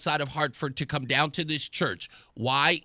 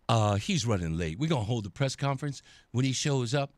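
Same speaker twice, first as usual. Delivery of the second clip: sheepish organizer; 8 semitones lower than usual. Retold or imitated. Acting the organizer's sheepish answer.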